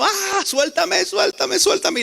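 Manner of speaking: put-on high, desperate child's cry to let go; child screaming. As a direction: shriek it like a little boy whose hair is pulled. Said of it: "el niño gritando".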